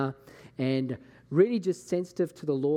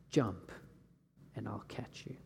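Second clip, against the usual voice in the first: soft whispered promise, much softer; hushed whisper; 5 semitones lower, 11 dB quieter than usual. Retold or imitated. Pastor voicing God's word from a vision, hushed whisper. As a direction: breathe it like a sacred secret.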